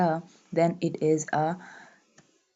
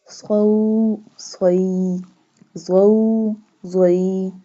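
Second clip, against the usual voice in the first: syllable drill; 4 dB louder, much slower than usual. Shown as a demonstration. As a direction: chant each letter, vowel and syllable for students to repeat. Teacher drills syllables for recitation.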